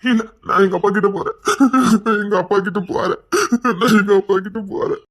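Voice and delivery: tearful, sobbing insistence; weeping plea to go to dad; crying for father. Acted. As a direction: whimper and sob between phrases.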